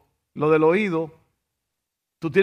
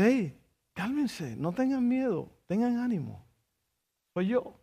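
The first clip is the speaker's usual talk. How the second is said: calming Jesus; 4 semitones higher, 8 dB quieter than usual. Acted. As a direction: settle a boatful of scared men gently. Jesus calms the frightened disciples.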